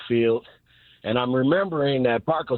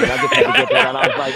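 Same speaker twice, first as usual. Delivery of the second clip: breathless laugh through the words; real laughter spilling out; 7 dB louder, faster than usual. Real, not acted. Caller cracks up telling radio hosts his mush story, laughing.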